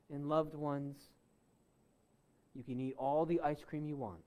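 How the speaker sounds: soft, low sermon appeal; hushed preaching. Real, not acted. Preacher turns hushed, searching appeal.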